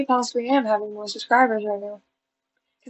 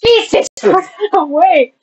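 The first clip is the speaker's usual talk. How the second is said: high, loud cursing outburst; exasperated yell; 7 semitones higher, 8 dB louder than usual. Real, not acted. Vlogger cursing at own action, exasperated yell.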